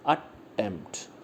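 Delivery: Attempt slow and soft, split as at, tempt; syllable by syllable. Shown as a demonstration. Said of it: "इसके अंदर दो syllable हो गए".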